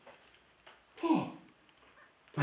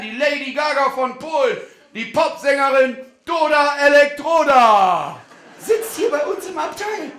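high, loud thrilled reveal; excited announcement; 8 semitones higher, 8 dB louder than usual. Acted. Guide announcing the pop star to his passengers, thrilled. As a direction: shout the star's name in awe.

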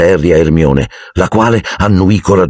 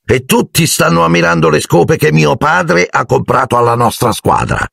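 higher smug boast; 9 semitones higher than usual. Acted. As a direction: show off father's brooms. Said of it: "con aria compiaciuta". (vanto compiaciuto e acuto)

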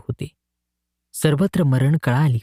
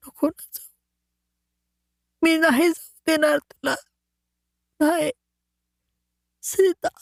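very high, fading, begging voice; dying plea; 12 semitones higher than usual. A narrator's reading. Dying man begs his vanished wife to stay, very high. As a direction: beg her not to leave, fading away. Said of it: "असा भास होऊन तो म्हणत होता".

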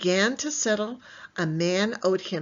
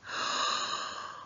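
mock gasp; 6 dB quieter than usual. Teacher reacts to picture.

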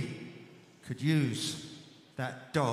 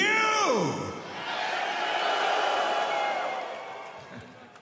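shouted laugh; 13 semitones higher, 4 dB louder than usual. Real, not acted. Laugh after shouted thanks.

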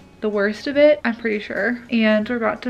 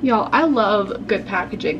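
usual speaking voice

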